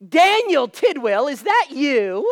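loud, high, drawn-out Southern exclamation; wife's Southern accent. Retold or imitated. Wife spotting her childhood friend, imitated loud Southern accent. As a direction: call out slowly in a hammed-up Southern accent. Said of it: "my wife say in her southern accent".

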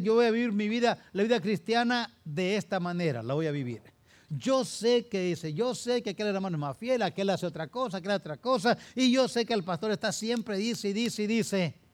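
high, loud, scornful imitation; mocking the careless parent. Preacher acting a dismissive parent to his congregation, loud mocking shout. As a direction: belt out the stubborn parent's boast, fast at first. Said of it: "que decides".

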